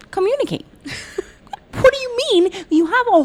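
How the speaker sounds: Communicate said high and fading; mocking high Communicate. Shown as a demonstration. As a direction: squeak out Communicate in disbelief. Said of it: "This is what pisses me off".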